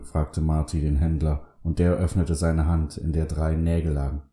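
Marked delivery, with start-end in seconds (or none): none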